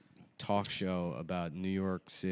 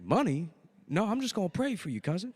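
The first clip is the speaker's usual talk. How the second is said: high-pitched incredulous hustler; high, evasive character voice; put-on investor voice; 8 semitones higher than usual. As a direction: raise the pitch for a comic fake investor. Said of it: "why is he talk that way".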